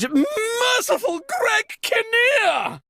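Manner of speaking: high, shocked shout of a made-up oath; startled cry. Acted. Host startled by the blackout, high shocked shout. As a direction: yelp the oath in sudden alarm.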